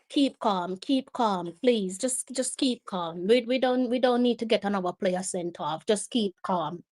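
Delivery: soft, low, anxious coaxing; subdued pleading. Real, not acted. Fan begging player to keep calm after red card, subdued plea. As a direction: plead softly, as if steadying the player.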